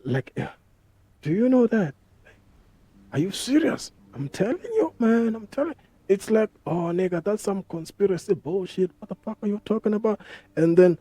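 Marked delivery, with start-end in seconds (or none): 1.2-5.8 high-pitched, disbelieving back-and-forth; incredulous exchange. Acted. Acts out excited conspiracy exchange. 6.7-10.2 high, fast dismissive sceptic; mock disbelief.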